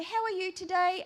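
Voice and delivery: high, singsong bird-like question; little bird voice. Retold or imitated. Asking the Lord after his day, little bird voice. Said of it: "in my little bird voice".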